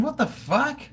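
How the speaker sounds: high, startled squawk of disbelief; jolted by the idea, voice shoots up; shocked outburst. Real, not acted. Streamer recoils at a chat request, shocked high. Reacting to nipple piercing suggestion.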